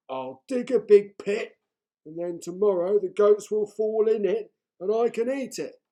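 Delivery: the troll pleased with his plan; scheming troll. A narrator's reading. Troll thinking aloud.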